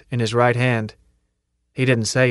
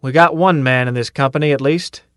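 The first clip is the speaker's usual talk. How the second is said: loud, higher calling out to the whole company; 5 semitones higher, 5 dB louder than usual. Acted. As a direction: shout the dare across the ranks, pushing louder. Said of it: "called out".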